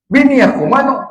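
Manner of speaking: high, loud demand for his wife; frantic husband shouting. Acted. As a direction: yell it down the well.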